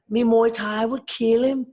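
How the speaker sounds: high, loud, slow mock Liverpool Scouse accent; a cocky Scouse boast, hammed up; bad Scouse accent. Darren Till boasting about beating Wonder Boy, in a mock Scouse accent. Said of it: "Again, in my shit Scouse accent".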